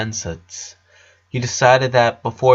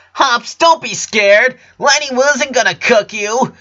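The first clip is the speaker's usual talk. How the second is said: put-on high, slow kid's call after her frog; little girl calling out; little girl yelling; 12 semitones higher, 5 dB louder, slower than usual. A narrator's reading. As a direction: shout after the runaway pet, pitched high. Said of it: "was yelling the little one".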